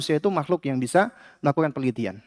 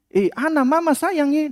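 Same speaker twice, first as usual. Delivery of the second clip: put-on a Maluku mother calling her child fondly, high and loud; loud loving mother; 10 semitones higher than usual. Mother greeting her child home from school, fond high shout. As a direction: affection hollered at full pitch. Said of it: "tapi ngomongnya keras, teriak".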